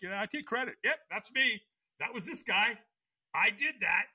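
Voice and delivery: high, cocky bragging voice; smug boast. Retold or imitated. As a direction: show off like you did it all.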